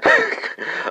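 real laugh. Real, not acted. Laughing at his own joke.

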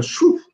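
a sudden high "shoo" to wave someone off; shooing sound. Retold or imitated. Mentor shooing away bad company, high shoo sound. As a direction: flick them away with a high, quick shoo.

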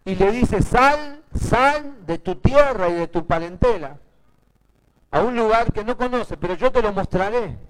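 high, emphatic pulpit storytelling; full-force preaching. Real, not acted. Preacher telling how God called Abraham, full-force preaching.

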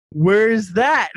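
startled shout; loud baffled question; surprised. Retold or imitated. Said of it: "I was like".

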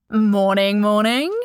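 loud, sing-out hello; bright, booming chirp; chirpy greeting. A narrator's reading. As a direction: chirp it loudly, ending on a rise. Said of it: "he chirped in a placeless British accent".

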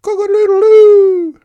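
very high, very loud crowing; rooster's crow. Acted. Rooster calling out in the farmyard, loud crow. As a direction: crow it out at full voice. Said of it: "crowed the rooster".

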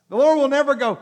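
normal talking voice

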